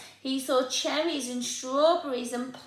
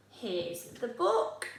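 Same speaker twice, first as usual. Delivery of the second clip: ordinary delivery, nothing marked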